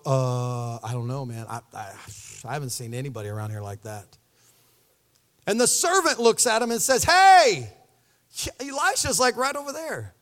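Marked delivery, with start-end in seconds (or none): 0.0-4.2 much lower, dull shrugging voice; dragging a low, lazy shrug into words; clueless mumble. Retold or imitated. Kings shrugging, twiddling their thumbs. 7.0-10.1 loud, high first word, then a casual point; a bright "Hey" that settles quickly; eager servant. Retold or imitated.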